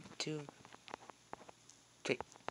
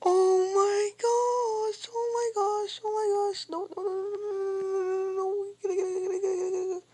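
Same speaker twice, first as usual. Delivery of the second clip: panicked scream; 4 dB louder than usual. Real, not acted. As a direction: wail in terror into a stream of no's. Attacked in the game, panicking.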